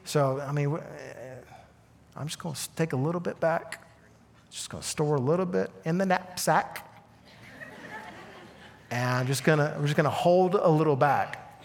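soft, conspiratorial mumble; sneaky hoarder. Retold or imitated. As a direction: sneak extra food into the knapsack. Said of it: "And they're like".

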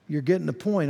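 ordinary delivery

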